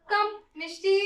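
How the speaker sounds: normal talking voice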